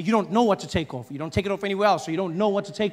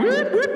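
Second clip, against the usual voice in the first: imitated police siren; 4 dB louder than usual.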